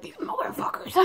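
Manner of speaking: mock mom grumbling in a whisper; whispered, voiceless mom impression; imitating annoyed mom. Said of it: "My mom's probably like".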